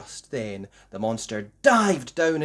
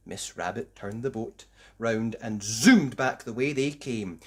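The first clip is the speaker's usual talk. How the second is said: usual speaking voice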